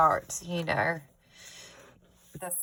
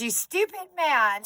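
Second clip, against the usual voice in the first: higher, louder scolding wife; angry wife; 10 semitones higher, 4 dB louder than usual. Acted. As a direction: scold him like a furious wife. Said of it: "she cried".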